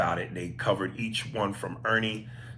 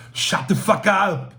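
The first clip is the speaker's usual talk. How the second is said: put-on high, loud, slow Austrian-accented shout; hammed-up Austrian action-hero yell; Schwarzenegger; 10 semitones higher, 9 dB louder, slower than usual. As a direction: bellow it slowly with an Austrian accent. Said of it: "Hearing Schwarzenegger tell someone to shut the fuck up".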